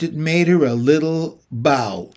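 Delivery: plain reading voice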